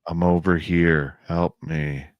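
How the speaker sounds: slow, flat and lifeless pleading; imitating the non-human voice, mild monotone; emotionless voice. Eerie voice luring them from the trees, lightly voiced, no emotion. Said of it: "there's no emotion".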